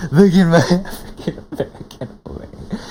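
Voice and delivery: helpless laughter swallowing the words; laughing quote. Retold or imitated. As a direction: quote it while cracking up. Laughing while repeating clip's line.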